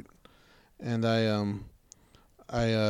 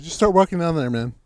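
louder, higher repeat of an order; insistent dismissal; 5 semitones higher, 5 dB louder than usual. Retold or imitated. Storyteller sending his useless helper off down the road, insistent. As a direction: push the order with raised voice.

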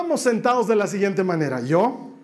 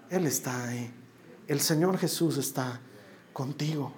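soft, lower, intimate sermon close; tender preaching; 4 semitones lower, 4 dB quieter than usual. Acted. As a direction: reassure each listener quietly. Preacher's soft closing application.

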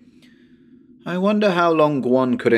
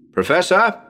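raised, questioning call; 8 semitones higher, 5 dB louder than usual. Acted. Calling for the missing professor, raised and questioning. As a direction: call up into the empty house.

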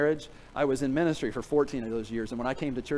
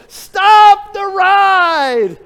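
drawn-out full-throated yell, much higher and louder; 17 dB louder, much slower than usual. Retold or imitated. Rider yelling at the operator to stop, full-throated yell. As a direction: scream it to stop the spinning ride. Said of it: "I yelled again".

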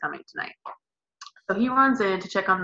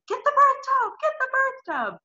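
very high urgent screaming; screaming orders. Retold or imitated. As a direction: scream the order at your husband. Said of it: "'cause I'm screaming".

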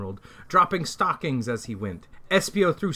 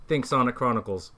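ordinary delivery

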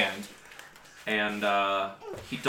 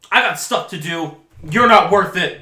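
higher, much louder defiance; defiant boy; 7 semitones higher, 11 dB louder than usual. Acted. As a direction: spit it out and flee. Boy standing ground.

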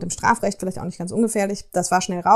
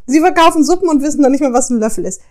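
higher and louder indignant rant; mock outrage; 5 semitones higher, 7 dB louder than usual. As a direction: translate her fury with full indignation. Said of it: "nach dem Motto". (gespielte Empörung, höher und lauter)

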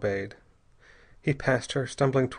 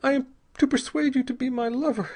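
high, halting, hopeless woman; despairing, high-pitched admission; dully; 12 semitones higher than usual. A narrator's reading. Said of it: "she said dully".

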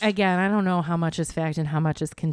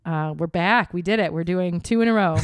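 podcast host intro; 4 dB louder than usual. Acted.

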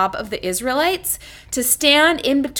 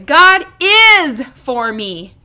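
is drawn out slowly and louder; stress on IS; 5 dB louder, much slower than usual. Shown as a demonstration. Preacher leading listeners, stress on is. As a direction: hold the word is. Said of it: "let's say that phrase".

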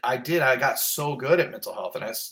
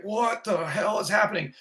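much higher, panicky question; alarmed disbelief; 9 semitones higher than usual. Retold or imitated. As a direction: ask it like you're suddenly triggered. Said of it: "and I was like".

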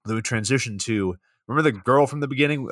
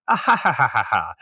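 villain laugh. Retold or imitated. Villain ends his monologue.

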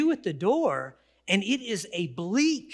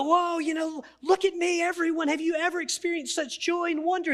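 high, showy look-at-me voice; mock boasting; 9 semitones higher than usual. Mocking people who think their good times are unique, high and boastful. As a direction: puff it up like a show-off. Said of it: "we act like".

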